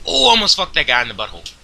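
loud, high, rushed game reaction; startled outburst. Real, not acted. Player reacting to a near miss in the game, startled outburst. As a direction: blurt it out, loud and quick.

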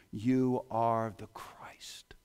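slow, hushed whisper; awed whisper. Acted. Peter confesses to Jesus in a slow whisper. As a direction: breathe it in awe.

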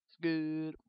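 a loud quote from Bruce Almighty; Bruce Almighty impression. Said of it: "rip-off of Bruce Almighty".

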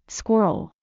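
squirrel said carefully in two parts, skwer-uhl, falling at the end; broken-down pronunciation. Shown as a demonstration. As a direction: break squirrel into its pieces for a learner. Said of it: "It helps to break it down".

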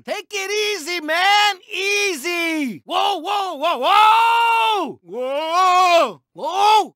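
high shouted whoa, whoa; alarmed protest. Acted. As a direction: yell at them to back off your paint. Car being bumped and pushed.

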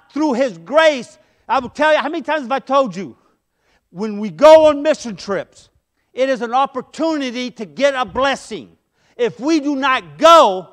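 loud, driving sermon delivery; full-force preaching. Acted. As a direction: preach it out to the whole church. Preaching loudly on grace and missions.

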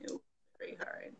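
soft, breathy whispered echo. Real, not acted. Interviewer echoes quietly in agreement.

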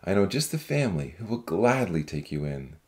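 deep, kindly man's voice; low, confident comfort; earnest promise. Acted. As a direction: reassure her, pitched very low. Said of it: "he promised".

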